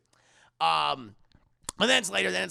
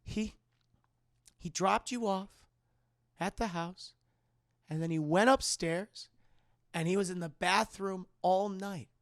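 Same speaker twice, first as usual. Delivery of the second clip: halting phrases with long pauses; haunted mother; 3 semitones higher than usual. Retold or imitated. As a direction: recount it piece by piece, building in pitch. Mother's halting acted account.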